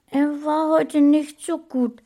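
hohe Kinderstimme, etwas bedrückt. A narrator's reading. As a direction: klein und traurig. Kind antwortet traurig. (hohe, bedrückte Kinderstimme)

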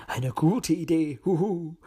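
slow, drawn-out owl approval; leisurely old owl with a hoot; hooting uncle owl. A narrator's reading. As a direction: agree slowly, ending on a hoot. Voices Uncle Owl agreeing.

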